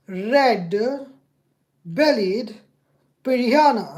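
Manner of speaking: loud, word by word with long gaps; loud voice command. Real, not acted. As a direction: spell it out for a stubborn machine. Repeats command to failing assistant.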